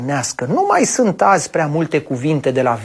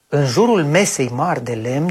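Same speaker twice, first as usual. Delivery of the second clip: plain reading voice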